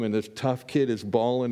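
usual speaking voice

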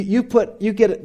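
usual speaking voice

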